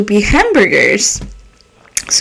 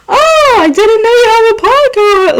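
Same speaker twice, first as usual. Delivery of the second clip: high, bright mock-surprised quote; lightly voiced surprised coworker; teasing coworker; 9 semitones higher than usual. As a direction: play the coworker gently teasing. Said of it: "like, like that".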